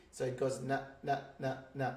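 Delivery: na, na, na, na, all beats the same, soft and level; even rhythm. Shown as a demonstration. Teacher tapping out the even rhythm of Felicity softly. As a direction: tap out the syllables evenly. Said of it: "no expansion of any phonetics".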